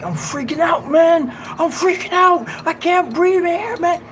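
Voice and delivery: high-pitched, loud imitation of a man panicking; panicked screaming. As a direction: shriek like someone trapped without air. Retells van-mate panicking for air.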